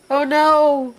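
high, loud shocked cry. Real, not acted. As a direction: yelp it out in dismay. The eggs are broken.

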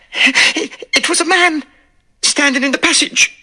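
high, breathless, scared maid; frightened maid. Acted. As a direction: stammer it out in fright. Frightened maid after scream.

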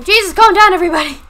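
sudden loud, high outburst; exasperated shout. Real, not acted. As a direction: yell at everyone to settle down. Exasperated at others in the game.

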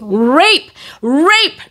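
high-pitched mock scream for help; urgent re-enacted scream, held below full volume; retold scream. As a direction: cry the alarm out, pitch leaping up. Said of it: "I was screaming".

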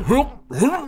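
high, whooping gag noise; mock retching. Streamer showing how vomiting should be animated, mock retching. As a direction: imitate someone heaving. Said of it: "Animated him going".

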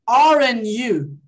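"Aren't you" drawn out very slowly; slow tag demo. Shown as a demonstration. Teacher models the tag question slowly. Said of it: "Aqui, olha".

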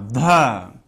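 ध with a breathy puff of air; aspirated dha. Shown as a demonstration. Teacher showing how the fourth letter ध is read. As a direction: breathe out hard on ध. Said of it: "चौथा अक्षर कैसे पढ़ते हो आप".

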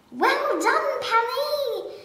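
high, loud cheer; cried out. A narrator's reading. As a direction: praise him at full voice. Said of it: "cried the giraffe".

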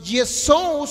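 Yesous slow and loud, the O-U drawn out; diphthong demo. Shown as a demonstration. Teacher pointing out the diphthong, Yesous slowly stretched. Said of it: "si usted ve el diptongo".

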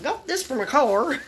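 high, slow Appalachian drawl; appalachian accent. Shown as a demonstration. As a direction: put on a thick Appalachian hillbilly voice. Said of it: "Don't I sound like an old hellion woman?".

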